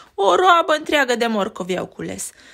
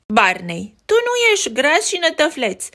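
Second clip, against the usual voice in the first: Betty indignant; 6 semitones higher, 3 dB louder than usual. Acted. Betty defends her brothers.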